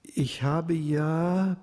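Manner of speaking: zögernd gedehnt begonnen; bedächtig ansetzen, Wort für Wort; langsam. A narrator's reading. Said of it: "begann der junge Mann langsam". (Arno beginnt seinen Vorschlag langsam und bedächtig)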